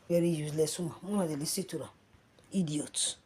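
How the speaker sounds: quiet scornful "Very useless woman"; soft contempt. Real, not acted. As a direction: drop "Very useless woman" low and soft in disgust. Contemptuous muttered insult.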